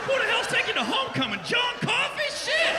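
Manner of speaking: dad yelling at his daughter about the huge date; shocked yell. Retold or imitated. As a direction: scream it in disbelief.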